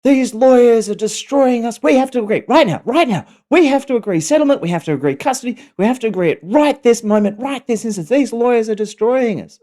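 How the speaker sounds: high, loud, frantic ex-wife impression; losing it. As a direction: imitate someone hysterically demanding action at once. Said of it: "she lost it".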